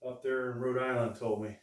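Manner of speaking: ordinary talk, nothing marked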